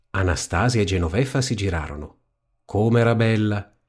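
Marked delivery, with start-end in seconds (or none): none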